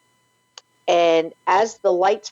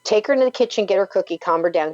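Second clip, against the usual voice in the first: ordinary talk, nothing marked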